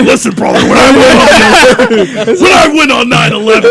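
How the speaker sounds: wrestling promo. Acted. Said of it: "do it like a wrestling promo".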